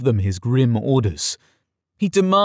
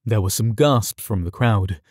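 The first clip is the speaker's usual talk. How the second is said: plain reading voice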